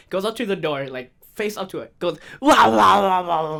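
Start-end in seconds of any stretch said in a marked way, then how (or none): 2.4-3.6 put-on loud, unhinged kid noise; super loud wah-wah. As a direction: yell nonsense wah-wahs right at the door. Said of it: "super, super loud".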